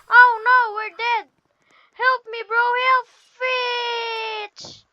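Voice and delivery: panicked cries. Real, not acted.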